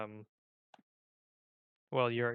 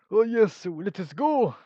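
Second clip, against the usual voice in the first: high, very loud mock Czechoslovakian accent; 7 semitones higher, 12 dB louder, slower than usual. Vlogger guessing at a character's voice, loud Czechoslovakian accent. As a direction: shout in an unsure Czechoslovakian accent. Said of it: "like, Czechoslovakian".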